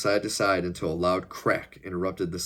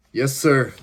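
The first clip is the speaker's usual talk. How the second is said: brief, louder, higher acknowledgement; shouted reply; 6 semitones higher, 8 dB louder than usual. A narrator's reading. Henchman to his boss on receiving orders, shouted reply. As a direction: call it out promptly. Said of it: "Holger shouted as he motioned".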